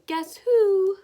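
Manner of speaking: spring it on her like a hidden visitor; child in disguise calling "Guess who?" at grandma's door; playful disguise call. Pretend. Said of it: "Put on a disguise and say".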